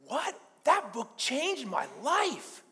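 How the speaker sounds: high-pitched, drawn-out amazed reaction; astonished excitement. Retold or imitated. Brother hears the book named, acted astonished excitement. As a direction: imitate someone floored with excitement.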